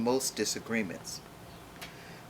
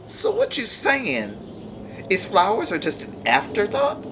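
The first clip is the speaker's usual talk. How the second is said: indignant disbelief; 6 semitones higher than usual. Acted. Offended flowers challenge vegetables.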